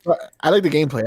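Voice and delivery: ordinary delivery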